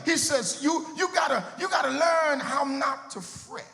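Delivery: preaching. Acted. Pastor urging the flock not to fret, rising preaching call. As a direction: lift it back toward the whoop.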